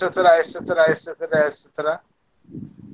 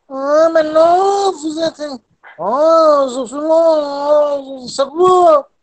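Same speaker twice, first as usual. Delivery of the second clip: higher, louder mock Parisian French, slow and drawn out; Parisian accent; 8 semitones higher, 4 dB louder, slower than usual. Teacher mimics a Parisian speaker for his students, high and loud Parisian accent. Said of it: "je ne vais pas imiter l'accent parisien".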